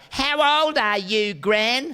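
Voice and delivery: put-on a small child asking innocently, high and slow; little kid. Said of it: "This little kid said".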